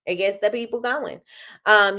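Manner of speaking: ordinary talk, nothing marked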